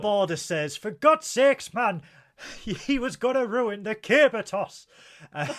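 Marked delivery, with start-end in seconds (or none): 0.8-4.9 high, loud Scottish exasperation; hammed-up Scottish outburst breaking with laughter; mock Scottish. Host reading Joe Border's comment, mock Scottish outrage.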